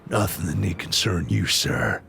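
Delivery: low, composed character voice; deep, restrained delivery; voice option one. Shown as a demonstration. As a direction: keep it deep, falling on sir. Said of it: "I'll give you voice one".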